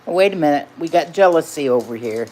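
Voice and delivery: normal talking voice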